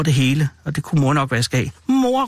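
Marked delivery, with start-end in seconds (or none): 1.9-2.3 high-pitched call for mother. A narrator's reading. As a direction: call out to mum, pitched well up. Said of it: "råbte lillebror". (råber på mor)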